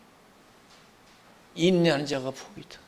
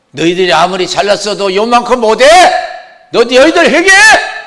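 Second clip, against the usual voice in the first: thundering rebuke, much louder and higher; God rebuking; 10 semitones higher, 18 dB louder than usual. Retold or imitated. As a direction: roar the rebuke down at the friends. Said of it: "친구들을 책망했잖아요". (크게 호통치는 꾸짖음)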